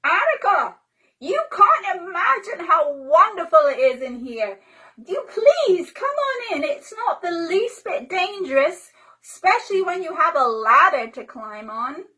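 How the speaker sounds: put-on boy calling up from inside the tree, thrilled; Tommy calling. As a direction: call up with delight. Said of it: "Tommy called out".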